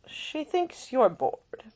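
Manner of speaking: drawn-out and knowing; lightly voiced teasing lord. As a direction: uncle gently needling. Isleston teases Nick.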